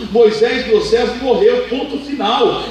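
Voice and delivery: firm rebuke. Acted. God rebuking Josué.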